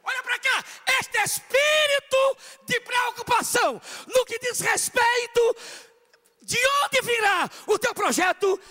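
loud, high, full-force preaching; fiery preaching. Acted. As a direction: preach it to the whole hall.

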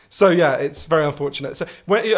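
ordinary talk

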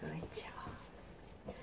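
soft whispered praise; whispering. Real, not acted. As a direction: whisper it gently. Unvoiced whisper.

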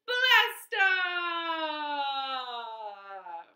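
high, loud blast-off shout; excited shout. Shown as a demonstration. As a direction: shout it like a launch. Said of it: "Let's go to the moon".

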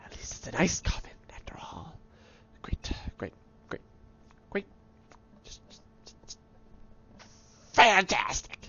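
whispered, soft sarcastic remark. Real, not acted. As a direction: breathe it out dryly under your breath.

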